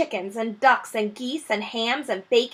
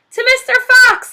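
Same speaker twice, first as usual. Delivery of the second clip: much higher, much louder cheer; shouting; 11 dB louder than usual. Acted. The animals toasting Mister Fox, shouting together. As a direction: shout it as a crowd. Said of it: "they all shouted".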